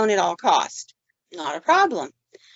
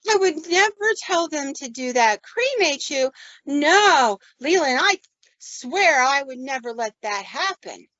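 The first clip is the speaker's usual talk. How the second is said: agitated denial; 6 semitones higher, slower than usual. Retold or imitated. Patient insisting to Leland she'd never allow cremation, agitated and higher. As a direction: act her frantic denial, pitched up. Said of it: "she's getting really agitated".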